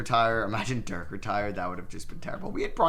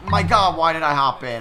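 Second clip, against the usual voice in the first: loud, high cry of frustration; exasperated outburst; 5 semitones higher, 10 dB louder than usual. Real, not acted. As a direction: blow up at your own mistake. Panicking over bad play.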